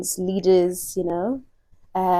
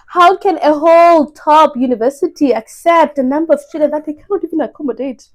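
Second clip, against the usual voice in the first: high, loud outraged rant; loud incredulous outrage; indignant; 7 semitones higher, 8 dB louder than usual. Retold or imitated. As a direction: fire it off in disbelief.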